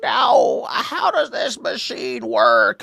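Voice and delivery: put-on high, slow, puzzled old-timer voice; confused old lady. Comedian mocking his future old self, confused old voice. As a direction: creak out a baffled old woman's question. Imagines himself old at Dollar General.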